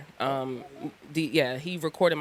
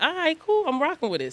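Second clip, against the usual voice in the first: high amused; 11 semitones higher than usual. Retold or imitated.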